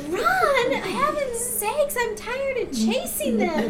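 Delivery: high, loud, laughing mock protest; laughing exasperation. Tired mother protesting to grandma, laughing and high. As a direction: squeal it out through laughter, worn out. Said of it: "I'm like".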